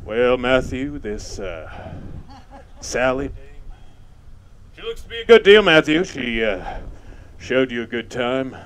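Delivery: low, halting movie tough-guy voice; Humphrey Bogart imitation. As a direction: imitate a laconic screen star, long pauses. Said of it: "You don't know Humphrey Bogart?".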